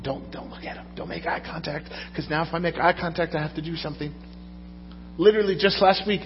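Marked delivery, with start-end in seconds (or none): 0.0-4.1 soft, hushed mock self-talk; quietly imitating his own uneasy thoughts; hushed inner thought. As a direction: mutter it like a nervous inner voice. Inner voice avoiding a beggar.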